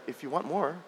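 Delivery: put-on soft, gentle old man's reply; a quiet, smiling old man in a dream; gently, with a smile. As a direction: answer softly, kind and amused. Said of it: "the old man with a smile just said".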